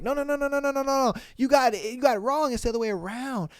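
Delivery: high-pitched string of protests; imitating a boy backpedalling; frantic denial. Retold boy hastily denying a crush.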